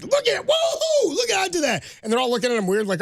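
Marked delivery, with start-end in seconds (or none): 0.0-1.8 very high, slow mock astonishment; Coach Carter impression. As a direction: squeal it like a coach amazed at himself. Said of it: "He's like".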